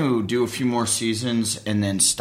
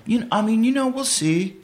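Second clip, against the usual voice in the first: unsure actor friend; 11 semitones higher than usual. Retold or imitated. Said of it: "And they're like".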